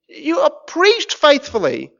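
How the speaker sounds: ordinary talk, nothing marked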